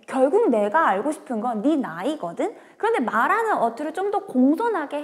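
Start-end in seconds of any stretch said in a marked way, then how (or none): none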